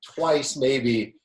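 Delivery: high, loud, drawn-out outburst; mock rage. Client recalls rage typing at the editor on a Saturday night, mock rage. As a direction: re-enact an exasperated wail, stretched out. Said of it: "I have rage typed at Daniel".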